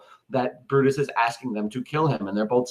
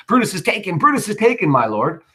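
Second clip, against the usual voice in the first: excited soldier's cry; 8 semitones higher than usual. Acted. Soldiers crying to Antony that Brutus is caught, excited. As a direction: cry it out as news of victory.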